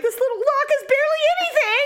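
high, nervous mock worry; mock fright. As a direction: fret about the flimsy lock. Imagines herself scared in RV.

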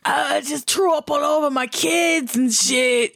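high, put-on sick customer voice; hammed-up prank call voice; prank caller voice. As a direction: play a queasy caller complaining on the phone. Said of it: "You remember calling the Burger King?".

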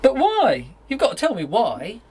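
urgent, pressing questions; anxious insistence. Acted. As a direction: demand an answer, alarmed. Pressing girlfriend, acted scene.

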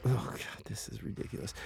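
breathless under-the-breath whisper; whispered aside. Real, not acted. Host reacting to a filthy question, whispered. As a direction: mutter it away from the mic.

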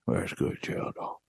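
soft, slowed murmur; half-whispered savoring; quiet murmur. Acted. Savoring Jello, fading.

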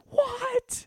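high, squeaky mock gasp of surprise; fake-gasp at the Santa spoiler; mock shock. Host reacting to Santa spoiler, high mock shock.

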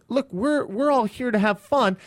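high, pleading mock voice; lightly voiced nervous comic; placating comic. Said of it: "And he's like".

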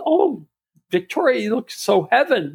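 imitated high-pitched gush; gushing admiration. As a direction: coo a high, admiring compliment as the friend. Retells friend gushing over new look.

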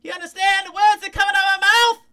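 screeched very high and loud, shrill mock outrage; Chris Tucker impression. Host shows how people imitate Chris Tucker, a loud high screech. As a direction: yell it way up high like a comic. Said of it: "like, a Chris Tucker".

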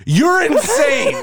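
high, slow, laughing you're insane; laughing disbelief. Real, not acted. Laughing at the cohost's absurd claim, high and slow. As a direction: crack up in disbelief.

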